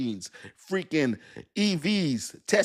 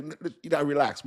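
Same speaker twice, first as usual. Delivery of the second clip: normal talking voice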